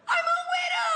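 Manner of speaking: very high, hammed-up shout; dad's wailing outburst. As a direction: wail it like a melodramatic bereaved father. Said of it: "Then he goes".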